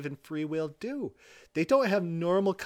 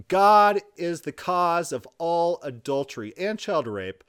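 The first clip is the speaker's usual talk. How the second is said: ordinary talk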